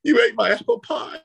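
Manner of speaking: high, hammed-up crying accusation; squeaky mock sob, far above your own voice; mock tearful wail. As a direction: imitate someone wailing through tears. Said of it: "she was in blistering tears".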